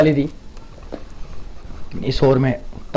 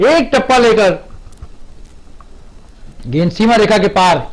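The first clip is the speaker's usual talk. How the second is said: excited commentary; 6 semitones higher than usual. Real, not acted. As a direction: ride the excitement to the rope. Ball crosses boundary. (उत्साहित commentary)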